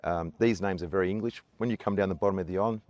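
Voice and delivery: ordinary talk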